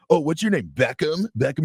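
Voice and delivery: high, teasing questions; making fun. Retold or imitated. Lady makes fun of the fan's jersey name, mock-curious teasing. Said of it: "we were making fun of him".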